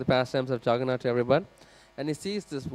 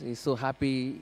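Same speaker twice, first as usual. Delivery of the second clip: usual speaking voice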